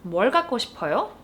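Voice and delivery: very fast, clipped-speed Korean question. Shown as a demonstration. Teacher modeling the shortened question very quickly. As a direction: rattle it off. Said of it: "into 뭘".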